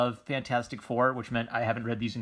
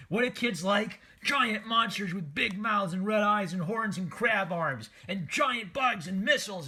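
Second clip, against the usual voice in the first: put-on excited kid voice; 8 semitones higher than usual. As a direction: gush like a thrilled little kid. Voicing what little kids want.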